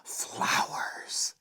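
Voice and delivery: "Flowers" breathed in a soft whisper; a soft hushed whisper of "Flowers". A narrator's reading.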